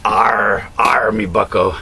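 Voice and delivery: lightly growled pirate greeting; hammy pirate captain hailing the crew. Acted. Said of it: "progressive liberal pirate ship".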